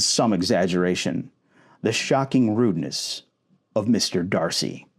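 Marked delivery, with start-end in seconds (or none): none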